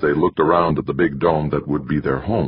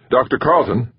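higher-pitched call for attention; 8 semitones higher, 3 dB louder than usual. A narrator's reading. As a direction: call his name across to him. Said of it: "Jamie called again, louder".

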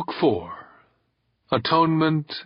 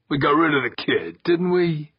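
quick, higher-pitched retort; snapped; 6 semitones higher than usual. A narrator's reading. Kirk defending himself to his boss, snapped. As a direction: fire it back defensively. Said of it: "Kirk snapped".

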